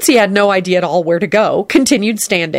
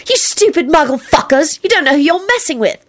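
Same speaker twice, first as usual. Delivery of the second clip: threat; 9 semitones higher than usual. Acted. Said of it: "Ginny threatens".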